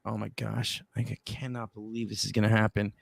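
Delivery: low-volume, disbelieving retold aside; acted hushed self-talk; muttering to himself. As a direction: mutter privately in dismay.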